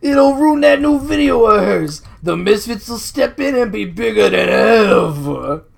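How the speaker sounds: loud, high, slow henchman voice; Zipper. Acted. Henchman gloating to his partner about the scheme, loud put-on voice. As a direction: crow over the evil plan, stretched out. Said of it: "I was just doing the Zipper voice".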